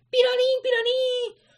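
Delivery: very high electronic siren sound; alarm noise imitation. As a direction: mimic a flashing alarm.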